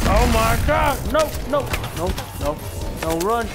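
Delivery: high, loud panicked cries; panicked shouting. Real, not acted. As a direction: yell it as you're cornered. Panic as enemy attacks.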